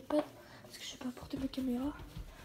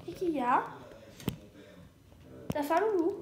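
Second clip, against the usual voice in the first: doting pet talk; 2 semitones higher, 4 dB louder than usual. Real, not acted. To her hungry pet: loud doting coo. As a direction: ask the pet fondly.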